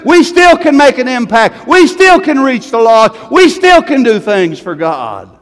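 loud, high, building pulpit cry; fiery revival preaching; full-force preaching. Acted. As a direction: preach it out to the whole church.

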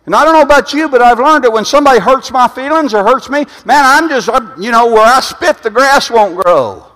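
high, loud preacher's cry; full-force preaching. Acted. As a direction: drive it home to the congregation.

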